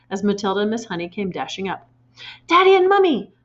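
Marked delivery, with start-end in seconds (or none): 2.5-3.3 high, loud breathless call; burst out. A narrator's reading. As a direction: gasp it out to her parents. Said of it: "Matilda burst out".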